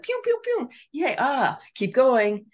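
very high, squeaky cheering voice; cheering body. Retold or imitated. She voices her body cheering her fake laugh on, squeaky and excited. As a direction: cheer yourself on like a giddy cartoon. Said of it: "But the body's like".

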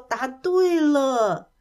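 drawn-out, slow cheerful confirmation; happy. A narrator's reading. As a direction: announce it gleefully, stretching each word. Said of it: "开心地笑了". (开心地拖长声音宣布)

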